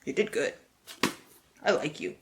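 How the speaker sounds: ordinary talk, nothing marked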